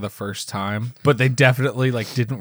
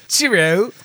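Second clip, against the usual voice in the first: higher, hammed-up English accent; put-on English voice, pitched up; mock English accent; 6 semitones higher, slower than usual. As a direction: play a silly cheerio-style Englishman. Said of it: "which is not in England".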